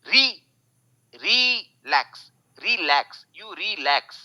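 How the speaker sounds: री high and long in relax; reversed stress. Shown as a demonstration. Teacher mimicking Bengali accent, stretched high REE. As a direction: push RELAX up on REE, let LAX fall. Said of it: "री को खींचूंगा".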